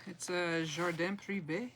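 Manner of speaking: "Jardin Privé" said slowly in a French accent, "Privé" rising at the end; lightly French "Jardin Privé", said slowly for the name. Shown as a demonstration. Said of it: "I have to say it with an accent".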